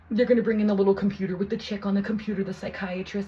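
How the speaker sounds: ordinary talk, nothing marked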